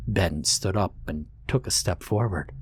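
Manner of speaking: ordinary delivery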